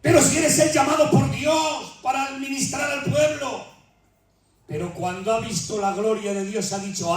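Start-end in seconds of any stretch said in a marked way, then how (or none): 0.0-3.6 soaring fervent preaching; impassioned preaching. Acted. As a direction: preach it with full fervor. Preaching at full force.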